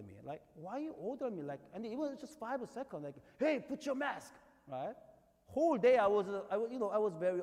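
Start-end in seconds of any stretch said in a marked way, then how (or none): 3.4-4.3 retold command. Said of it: "said very strongly to me like this".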